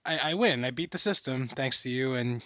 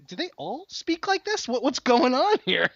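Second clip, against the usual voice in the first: mock bewilderment; 11 semitones higher, 3 dB louder than usual. Hearing Wisconsin accents, mock bewilderment.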